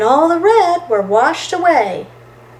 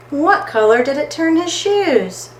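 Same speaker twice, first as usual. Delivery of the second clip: ordinary delivery, nothing marked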